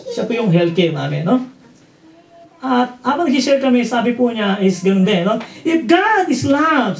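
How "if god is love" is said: questioning quote. Retold or imitated. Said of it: "sabi niya".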